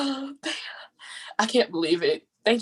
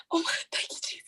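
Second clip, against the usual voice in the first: choked whispered thanks through tears; winning poet overcome, crying thanks to Jesus; 10 dB quieter, faster than usual. Real, not acted. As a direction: sob out the thanks. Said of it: "I'm done ugly crying now".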